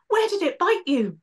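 high, anxious little creature asking fast; high anxious imp. Acted. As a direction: fuss over her like a frightened imp. Worried imp rushes to her side.

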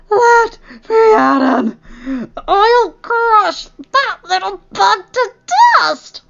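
high, strained, loud cry through gritted teeth; agonized fury. Acted. Injured Strat raging at his poisoner, straining against screams. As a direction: force out the threat through a scream. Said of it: "gritting his teeth against another scream".

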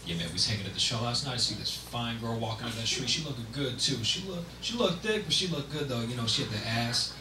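low, soft, fast street talk; low, hushed guy talking about a girl; indescribable character. Acted. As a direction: ramble low and quick, under your breath. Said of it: "this is my impression of it".

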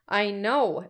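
normal talking voice

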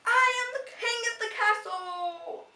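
loud, high triumphant yell; yelling king. Acted. Little brother on the pillows yelling to the girls, triumphant and loud. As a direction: yell it from the top of the pillow pile. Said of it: "and yelled".

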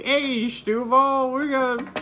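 loud high goofy dad impression; Mister Universe. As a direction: put on a loud, high-pitched cheer-up voice. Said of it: "So Mister Universe is here, Greg".

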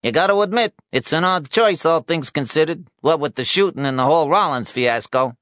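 high-pitched, loud, needling reporter. Acted. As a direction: needle him in a high, pushy voice.

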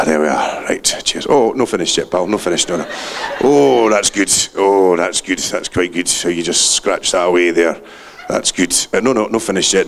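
low, contented dog voice; the dog voicing its own pleasure while scratching, low; dog's voice. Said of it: "me interpreting dogs".

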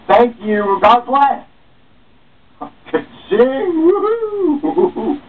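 gleeful high ka-ching cheer; excited cheer. Acted. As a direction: cheer like you just hit the jackpot. Celebrating a donation.

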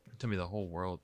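ordinary delivery, nothing marked

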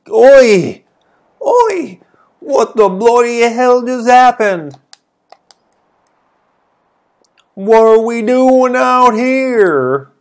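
put-on British girl, loud and rattled; British character voice. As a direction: shout it like a startled British lass. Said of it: "I don't know what kind of voice to give her".

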